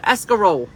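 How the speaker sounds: escarole punched loud, as a friend says it; imitated pronunciation. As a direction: hit escarole hard, ending on a long -ole. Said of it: "that's how Di would say it".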